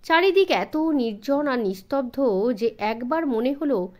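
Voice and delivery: ordinary delivery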